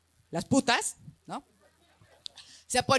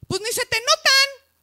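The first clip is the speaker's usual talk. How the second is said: halago agudo; 11 semitones higher, 6 dB louder than usual. Retold or imitated. Halago de desconocido.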